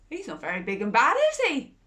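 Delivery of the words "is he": plain reading voice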